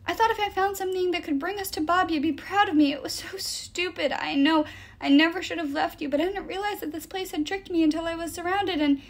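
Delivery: high, distraught confession; upset, pleading ramble; near sobbing. A narrator's reading. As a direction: pour out regret as tears take over. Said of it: "Then he burst into sobs".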